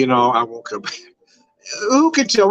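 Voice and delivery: ordinary talk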